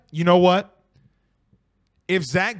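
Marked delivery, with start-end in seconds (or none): 0.1-0.8 louder emphatic. Real, not acted. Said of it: "repeats phrase louder".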